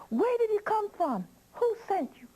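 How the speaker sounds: high-pitched indignant demand; angry old witch. Acted. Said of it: "She was so mad".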